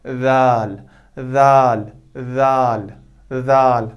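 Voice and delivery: जाल repeated with the tongue tip touching the front teeth; dhal drill. Shown as a demonstration. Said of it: "जैसे".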